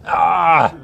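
sudden loud "Ah!" to wake the room; frustrated cry. Real, not acted. Teacher prodding a silent class.

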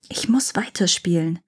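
plain reading voice

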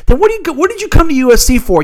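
outraged disbelief. Real, not acted. Host rages at the coach's excuse, outraged high question. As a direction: demand an answer, stunned and angry.

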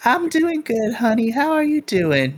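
put-on high, sweet old-lady voice; sweet grandma. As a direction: answer warmly like a grandma. GM voices Missus Yu replying.

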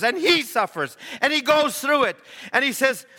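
high, driving preacher cadence; full-force preaching. Acted. Preacher telling congregation of a suffering minister, driving high preaching. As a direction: build each phrase as a ringing refrain.

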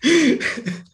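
a high burst of real laughter. Real, not acted. Guest laughing at the host's accent remark, high burst of laughter.

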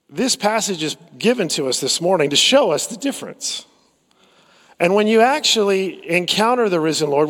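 usual speaking voice

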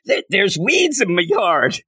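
high, slow petty complaint; mock her like a fussy neighbour; whiny Morgan Le Fay. Reviewer mocking the villain's petty complaints, high and whiny. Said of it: "am I now Morgan Le Fay?".

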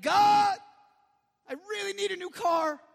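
loud, high, whiny begging; mock pleading prayer. Believer praying with bad motives, mock pleading. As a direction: beg like a spoilt petitioner, hammed-up.

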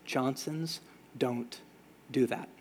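stern quiet warning. Retold or imitated. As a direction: lay it down low and slow, no argument.

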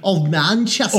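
hammy put-on English accent; shitty English accent. Finishing co-host's phrase, mock English accent. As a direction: overdo a bad English accent. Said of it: "in our shitty English accents".

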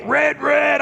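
"Red, red" shouted high and loud like a brute; archaic caveman shout. Retold or imitated. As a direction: bellow "Red, red" like a starving caveman. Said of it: "this sort of archaic".

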